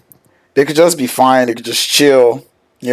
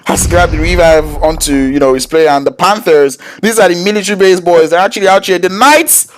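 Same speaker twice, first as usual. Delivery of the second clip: high-pitched, urgent esports fight call; excited play-by-play; 7 semitones higher than usual. Real, not acted.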